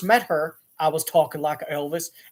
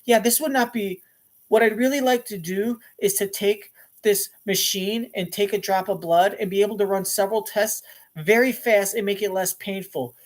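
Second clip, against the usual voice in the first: her natural higher voice; light, higher-pitched, earnest founder; Elizabeth Holmes; 4 semitones higher than usual. Retold or imitated. Founder pitches her machine, in natural higher voice. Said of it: "when she would talk like herself".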